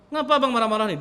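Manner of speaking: why are you so cross, confronted; puzzled wife. Retold or imitated. Wife confronts angry husband.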